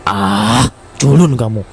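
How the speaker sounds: loud teacher telling the pupil off; teacher scolding. Acted.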